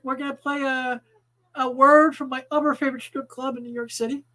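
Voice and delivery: usual speaking voice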